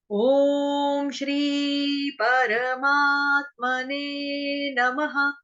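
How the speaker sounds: slow sacred chant of the verses; Sanskrit recitation. Acted. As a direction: intone each verse slowly.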